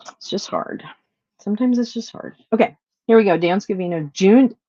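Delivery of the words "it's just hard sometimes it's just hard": quiet, hurt admission. Real, not acted. Admits she is struggling.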